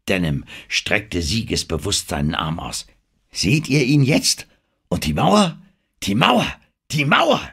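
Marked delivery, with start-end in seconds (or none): 3.3-7.4 high, loud cries climbing with each repeat; near-hysterical excitement. A narrator's reading. Denham shows the crew the wall, almost hysterical with excitement. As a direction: crow it triumphantly, rising ever higher. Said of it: "Er war fast hysterisch vor Aufregung".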